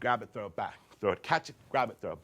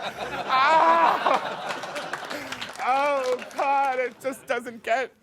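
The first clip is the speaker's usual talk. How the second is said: high, loud hammed-up drunken wail; shaking alcoholic; 13 semitones higher, 7 dB louder, slower than usual. Boy playing an alcoholic in his backyard, overwrought. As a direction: play a desperate, shaky addict in melodrama. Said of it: "shaking as if I were a fucked up alcoholic".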